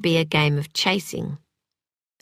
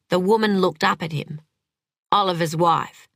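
ordinary delivery

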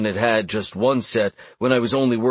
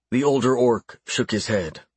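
ordinary delivery, nothing marked

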